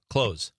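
"Clothes" said like "close", no TH; everyday "Clothes" that rhymes with "nose"; TH dropped in clothes. Shown as a demonstration. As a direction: drop the TH from "Clothes", straight into Z. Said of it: "Nobody says that".

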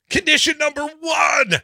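high, loud announcer shout; booming proclamation. Acted. Host announcing the first bail condition, booming proclamation. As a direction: proclaim it like a town crier.

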